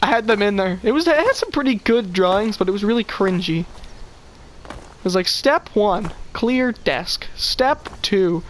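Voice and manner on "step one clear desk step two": slow, halting instructions with gaps between steps; deliberate step-by-step recital. Retold or imitated. Student reciting an old brochure on desk cleaning, slow and deliberate. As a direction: read out each step slowly and separately.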